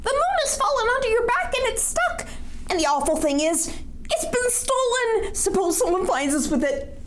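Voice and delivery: alarmed squirrel. Acted. Acting out the squirrel's alarmed speech.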